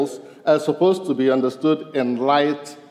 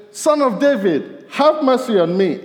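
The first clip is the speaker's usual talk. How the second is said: calling out; 8 semitones higher, 4 dB louder than usual. Retold or imitated. Blind man calling to Jesus.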